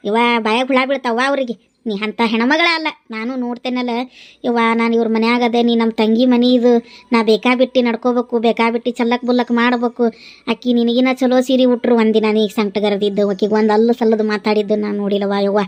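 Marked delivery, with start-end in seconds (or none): none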